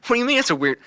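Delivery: high, loud mock disbelief; baffled retort. Retelling a reply to the country student, high baffled protest. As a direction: throw it back at them, incredulous and high.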